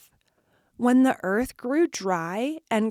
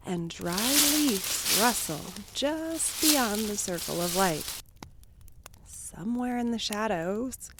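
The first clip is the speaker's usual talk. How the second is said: soft, unhurried campfire scene-setting; softly told spooky campfire opening; hushed spooky storytelling; 6 dB quieter than usual. Acted. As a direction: draw listeners into a quiet, eerie night.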